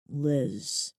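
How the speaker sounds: low, soft growl of the name. Acted. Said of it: "he growls".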